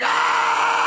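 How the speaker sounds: loud startled yelp; mock scream. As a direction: yelp like you've been ambushed. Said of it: "It's like".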